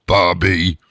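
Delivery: mock voicemail name drop; guy saying his name. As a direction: announce your own name into the voicemail, a bit loud. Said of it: "his voice just say his name".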